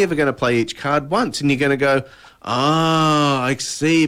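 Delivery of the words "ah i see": drawn-out "ah" of dawning understanding; acted realisation. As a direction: play the penny dropping. Acts out players learning solution.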